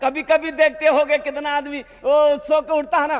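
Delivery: ordinary talk, nothing marked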